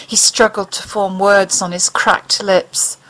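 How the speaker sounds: ordinary delivery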